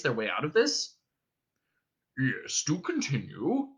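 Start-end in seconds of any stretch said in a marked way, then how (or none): 2.2-3.7 lightly voiced slow alien; Vogon. A narrator's reading. Vogon invites Arthur to go on, slow creature voice. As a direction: draw it out slowly, as a Vogon. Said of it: "invited the Vogon".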